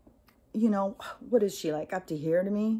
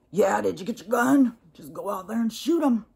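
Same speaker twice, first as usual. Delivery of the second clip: egging-on neighbor; 4 semitones higher, 7 dB louder than usual. Acted. Neighbors on Nextdoor urging each other on, mock belligerent. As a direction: imitate a riled neighbor, punching it out loud.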